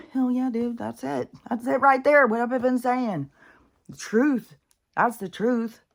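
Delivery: high, loud triumphant cheering; excited cheer. Retold or imitated. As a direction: cheer it like you finally got proof. Retelling own excited reaction.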